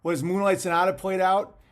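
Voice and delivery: ordinary delivery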